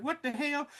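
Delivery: high, softened cry of disbelief; mock bafflement. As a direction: act out baffled shock without shouting. Retold shock at dead-body sight.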